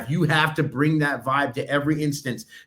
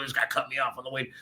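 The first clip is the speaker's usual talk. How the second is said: grumbling excuse; 8 dB quieter, faster than usual. Retold or imitated. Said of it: "I'm like".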